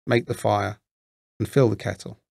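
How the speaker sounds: ordinary delivery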